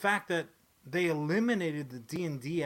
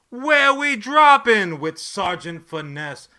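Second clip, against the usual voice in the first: hype announcer; 5 semitones higher, 14 dB louder, slower than usual. Acted.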